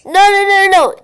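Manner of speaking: high, loud frantic warning; urgent no. Real, not acted. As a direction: stop them urgently. Stopping friend going to sewers.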